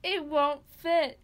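story narration. Acted. Reads a dark Christmas story.

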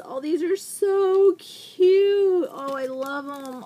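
high, loud gush, drawn out; real excitement. Real, not acted. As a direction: gush over something adorable, stretching the words. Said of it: "I'm so excited".

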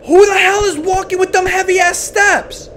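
high, loud complaint at the noise; annoyed yell. Real, not acted. Streamer yelling at footsteps in the game, annoyed and loud. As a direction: yell it out, fed up with the stomping.

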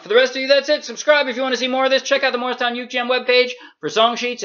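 upbeat outro. Acted. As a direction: rattle off the channel plug cheerfully. Sign-off outro to viewers.

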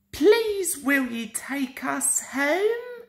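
gently begging to go home; children pleading. Acted. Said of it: "children ask".

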